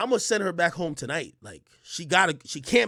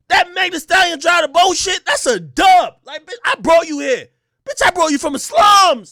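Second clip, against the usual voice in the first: loud, higher, heated yelling; angry rant; 9 semitones higher, 12 dB louder than usual. Retold or imitated. Narrator yelling at his date in the club. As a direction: chew her out hard.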